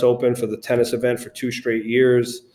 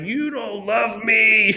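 high, slow, mock-whiny complaint with laughs; whiny middle child; 9 semitones higher, slower than usual. Son retelling his complaint to father, hammed-up whiny and high. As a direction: play the sulking kid who feels unloved, laughing through it. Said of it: "Middle child syndrome".

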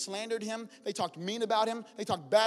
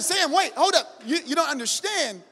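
higher and louder, pressing urgently; urgent pleading; 8 semitones higher, 10 dB louder than usual. Retold or imitated. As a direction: make him understand, insistently. Girlfriend urgently explaining family.

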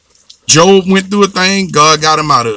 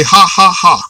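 a deliberate, slightly louder put-on laugh; forced laugh; 3 dB louder than usual. Shown as a demonstration. Preacher to congregation, a forced show-of-faith laugh. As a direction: push out a laugh on purpose, ha ha ha. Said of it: "Make yourself laugh".

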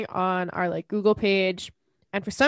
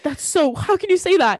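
high, louder scandalized protest; mock outrage; 6 semitones higher, 4 dB louder than usual. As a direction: act out being appalled, playfully. Acting out earlier outrage at name.

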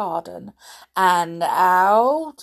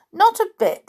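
dismissive; 11 semitones higher, 4 dB louder than usual. A narrator's reading. Tom dismissing worry.

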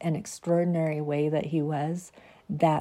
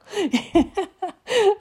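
high laugh; 4 dB louder than usual. Real, not acted. Laughing at her whining dog, high laugh.

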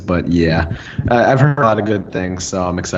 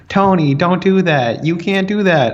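higher, drawn-out mock pleading; slow, raised-pitch scolding imitation; Pepper Potts; 7 semitones higher, slower than usual. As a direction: imitate a fretting partner, slow and high. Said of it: "she's just like".

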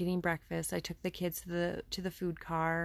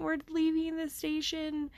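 put-on much higher, thrilled child voice; excited little kid; 11 semitones higher than usual. Son telling mama the train is leaving, higher and excited. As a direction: play a small boy bursting with joy. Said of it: "He's just exclaiming".

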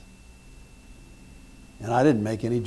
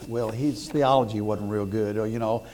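ordinary talk

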